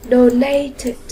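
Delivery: "Donated" ending in a separate "it" syllable; -ed read as "it". Shown as a demonstration. Teacher shows what E D becomes after t, "Donated" ending in "it". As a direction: close "Donated" on "it". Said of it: "chúng ta đọc là it".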